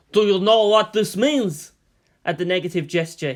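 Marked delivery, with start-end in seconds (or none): 0.1-1.7 louder, slower, eager challenge; fierce grin. A narrator's reading. Premier grinning at the ambassador, loud and eager. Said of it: "The Premier grinned fiercely".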